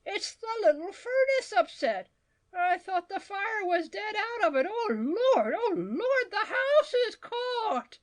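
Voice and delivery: high, wailing cry of panic; old woman shrieking in horror; panicked screaming. A narrator's reading. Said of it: "she rushed screaming into the kitchen".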